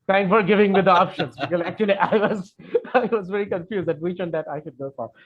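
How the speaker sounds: real amused laugh through the words. Real, not acted. Teacher thanking a student for the options, laughing.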